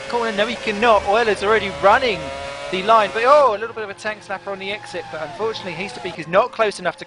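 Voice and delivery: rising race call, voice climbing high; excited commentary. Real, not acted. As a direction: call the overtake live, building excitement.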